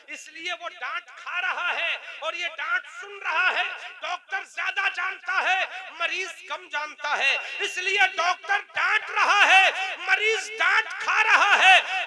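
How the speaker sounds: high, very loud hammering sermon; thundering preacher's argument; full-force preaching. Real, not acted. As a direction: drive each clause home to the congregation, shouting.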